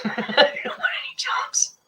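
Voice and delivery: high breathy giggling whisper; laughing whisper. Real, not acted.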